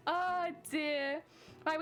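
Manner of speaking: ordinary talk, nothing marked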